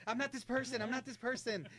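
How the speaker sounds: mock panicked insistence. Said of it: "you're like".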